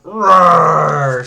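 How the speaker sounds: loud, long imitated roar; playful battle growl; fighting roar. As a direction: roar like you're charging into a brawl. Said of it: "Kevin just starts fighting these aliens".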